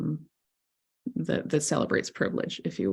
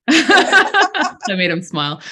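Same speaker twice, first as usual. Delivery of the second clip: laughing loud and slow; 9 semitones higher, 12 dB louder, much slower than usual. Real, not acted. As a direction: laugh through the words. Laughing at teasing a colleague.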